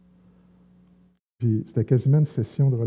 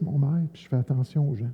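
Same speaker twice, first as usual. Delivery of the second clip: usual speaking voice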